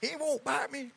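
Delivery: high-pitched impression of a snake handler; comic high voice for a reckless handler; mock snake handler. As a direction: squeak it out as a cocky snake charmer.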